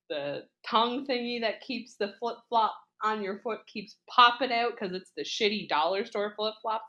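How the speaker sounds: normal talking voice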